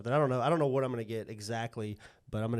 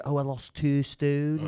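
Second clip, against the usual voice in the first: British woman; 2 semitones higher, 4 dB louder, slower than usual. Retold or imitated. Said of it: "whenever you hear a woman say".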